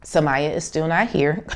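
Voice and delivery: normal talking voice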